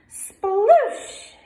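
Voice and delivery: high, loud, rising sploosh; duckling shouts as he jumps in, high and loud; excited sploosh. Acted. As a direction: shout the splash sound with glee. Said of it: "he cried".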